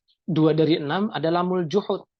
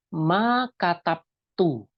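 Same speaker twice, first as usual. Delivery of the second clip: Ma sami'tu said slowly, word by word; teacher slowly modelling an Arabic phrase; slow demo; much slower than usual. Shown as a demonstration. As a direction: spell out Ma sami'tu slowly for students to repeat. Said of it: "teacher demonstrating Arabic phrase".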